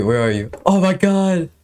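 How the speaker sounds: ordinary talk, nothing marked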